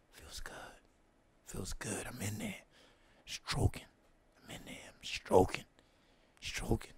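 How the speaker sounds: very soft "feels good"; breathy whisper. Retold or imitated. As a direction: whisper it, savoring. Reliving sex in whisper.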